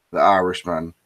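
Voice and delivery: normal talking voice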